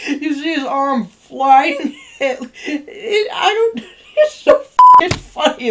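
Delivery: breaking up with real laughter while telling it; helpless real laughter retelling a funny scene; laughing. Real, not acted. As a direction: giggle through the words, high and loud.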